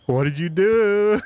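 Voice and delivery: loud drawn-out teasing ooh, then slow; mock the kid in trouble like the whole gym; a gym full of kids teasing someone sent to the office; teasing crowd ooh. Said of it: "everybody else in the gym is going".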